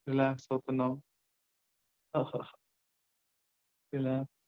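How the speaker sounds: even, unhurried relaxation voice with long pauses; guided meditation. Acted. Guide listeners to relax from shoulders to feet, guided meditation. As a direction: ease them into rest, one body part at a time.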